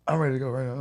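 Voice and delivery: high, pushy imitation of his girlfriend; mocking girlfriend voice. Man mocking his impatient girlfriend, high pushy voice. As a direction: mimic her whiny insistence to leave. Girlfriend insisting, retold quarrel.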